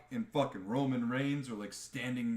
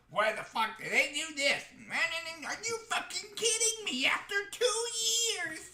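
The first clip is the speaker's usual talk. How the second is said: much higher, whining, near-crying complaint, slowing at the end; mock the fans who melt down online; whiny angry fan. Ranting fan on YouTube, hammed-up whiny outrage. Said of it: "like, saying".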